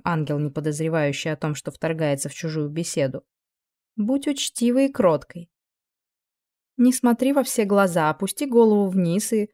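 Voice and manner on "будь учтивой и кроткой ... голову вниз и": angel voice. Acted. Angel instructs the girl.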